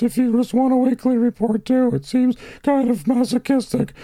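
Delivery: very high, hammed-up weeping; Weepy Voice Killer. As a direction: wail the complaint in a tearful falsetto. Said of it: "Weepy Voice conference call".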